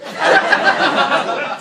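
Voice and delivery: loud high burst of laughter; big high laugh. Real, not acted. Speaker laughing at his own scholarship pitch, a loud high laugh. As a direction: crack up at your own joke.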